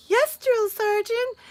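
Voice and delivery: very high, drawn-out sarcastic salute; rude mock obedience. Retelling how she was rude to the sergeant, mock-obedient. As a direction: sing it out slowly, insolently obedient. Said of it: "I was being rude".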